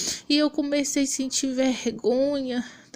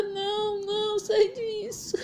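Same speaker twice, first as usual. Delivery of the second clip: high, frantic pleading cry; desperate plea; 8 semitones higher than usual. Retold or imitated. Aunt frantically begging niece to put the phone down, high desperate plea. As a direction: cry out for her to stop, panicked.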